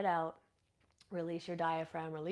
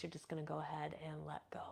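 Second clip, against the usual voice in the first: whispered release; 2 semitones lower, 10 dB quieter, faster than usual. Acted. Guiding a breath release.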